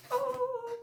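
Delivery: very high delighted thank you; excited squeal. Real, not acted. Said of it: "I'm so excited".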